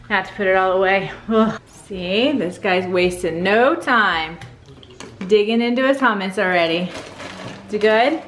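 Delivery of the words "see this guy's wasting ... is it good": usual speaking voice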